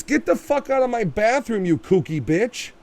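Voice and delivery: high mock yell; mock angry outburst. What the husband should shout at the intruder, a mock yell. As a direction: snap it like an outraged homeowner.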